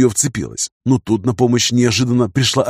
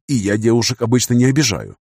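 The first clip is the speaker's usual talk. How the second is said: usual speaking voice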